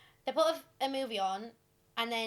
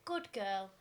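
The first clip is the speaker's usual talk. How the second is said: mock creepy guy; 4 semitones lower, 5 dB quieter than usual. Imagining it said to her.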